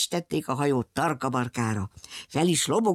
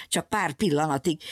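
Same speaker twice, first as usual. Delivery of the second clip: usual speaking voice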